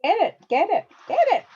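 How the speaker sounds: high, loud repeated cheer; rally the viewers to grab the book; excited urging. Real, not acted. Host urging viewers to get the book, excitedly.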